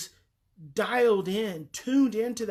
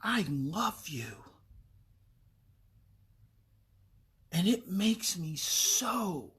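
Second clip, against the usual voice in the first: tender demo; slower than usual. Shown as a demonstration. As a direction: say it slowly and gently to the child. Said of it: "you're gonna say".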